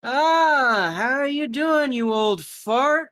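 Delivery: loud, high jovial greeting; loud, high, slow teasing welcome; hearty old friend. Acted. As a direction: boom out a cheerful hello to an old pal. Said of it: "he probably looks at the guy and goes".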